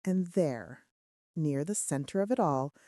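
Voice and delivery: ordinary delivery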